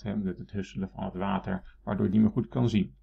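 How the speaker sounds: ordinary talk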